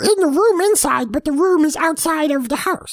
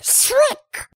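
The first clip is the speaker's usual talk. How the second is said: high mock slicing noise; throat-slit sound; 10 semitones higher than usual. Shown as a demonstration. Narrator showing the listener the kill sound, high and playful. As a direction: make the sound of a throat cut. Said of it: "Like that".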